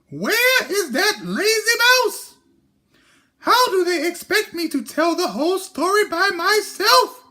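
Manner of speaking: very high squeaky hen voice; little red hen voice. Acted. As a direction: play a fussy little hen.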